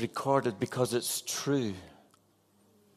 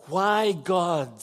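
loud, high cry to God; anguished scream; 7 semitones higher, 5 dB louder than usual. Acted. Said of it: "he screams".

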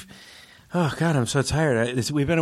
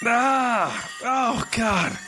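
waking up groggy; 8 semitones higher, much slower than usual. Acted. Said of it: "I just dozed off again".

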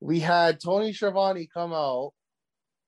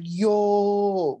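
excited yo; 6 semitones higher, 5 dB louder than usual. Retold or imitated. Crowd reacts to signing.

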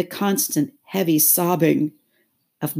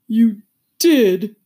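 high, loud, doleful retort; put-on turtle voice, insisting; insistent Mock Turtle; 7 semitones higher, 10 dB louder than usual. A narrator's reading. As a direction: insist in a woeful voice. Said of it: "said the Mock Turtle".

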